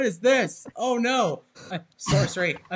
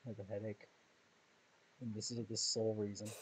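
much lower, much softer; low mutter; 11 semitones lower, 17 dB quieter, faster than usual. Pretend. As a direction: grumble under breath.

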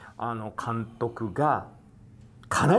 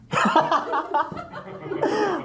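loud high burst of laughter; laughing; 3 dB louder than usual. Real, not acted. Host laughs at confession.

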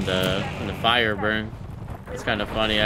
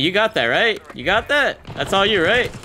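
excited; 9 semitones higher than usual. Real, not acted. Hyping teammate over kill.

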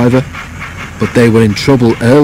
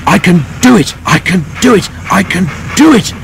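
high, straining, puffed repetitions; panting effort; 8 semitones higher than usual. A narrator's reading. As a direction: chant it in effortful bursts, like climbing a hill. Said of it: "he panted".

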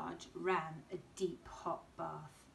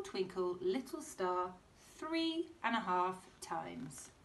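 ordinary delivery, nothing marked

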